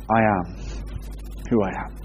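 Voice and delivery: low, hushed and grave; hushed voice of God; solemn divine answer. Acted. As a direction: speak it as God, quietly and weighty. God's solemn answer to Moses.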